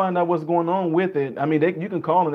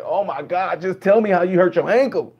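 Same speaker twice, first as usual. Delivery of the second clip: louder, higher mock impatience; exasperated; 4 semitones higher, 4 dB louder than usual. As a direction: beg them to get to the point, acting fed up. Impatient listener begging for the point.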